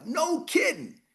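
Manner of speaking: high exclaimed no kidding; learning R J bought property, high excited exclaim; amazed. Retold or imitated. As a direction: shout it out in amazement. Excited reaction.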